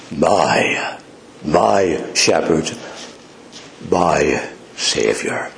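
"my" lingered on, soft and slow; stress on my. Shown as a demonstration. Preacher dwells on the possessive pronoun, softly stressed. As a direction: press each "my" gently. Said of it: "the personal possessive pronoun".

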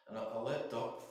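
usual speaking voice

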